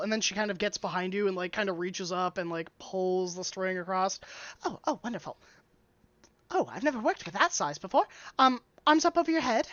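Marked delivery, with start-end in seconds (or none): 4.6-9.7 quick voice lifting high at the end; delighted dwarf tailor. Acted. Dwarf tailor measuring an unusually sized customer, delighted. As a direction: marvel quickly, then give a high-voiced instruction.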